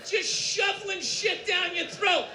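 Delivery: usual speaking voice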